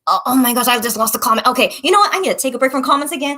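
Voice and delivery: ordinary delivery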